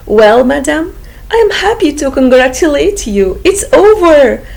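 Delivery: a bright, quick congratulation; doctor greeting the aunt, lightly voiced; cheerful doctor. A narrator's reading. Doctor announces birth.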